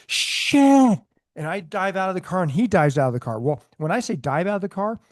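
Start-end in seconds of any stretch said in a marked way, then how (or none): 0.1-1.0 high, loud drawn-out yell; retold yell. Officer takes the bee for a spider, panicked yell. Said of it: "So I yell".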